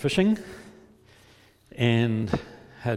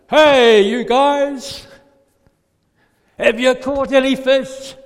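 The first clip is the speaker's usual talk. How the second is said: loud, high long-distance shout; big drawn-out yell across the water; 12 semitones higher, 12 dB louder, slower than usual. Acted. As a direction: holler to people a hundred meters away. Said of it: "So he probably yelled out".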